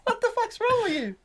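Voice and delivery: high, loud comic accusation; mock outrage. Real, not acted. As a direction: blame him in a shrill, joking rant. Blames friend, mock outrage.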